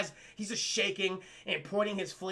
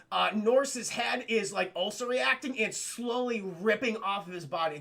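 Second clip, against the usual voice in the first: normal talking voice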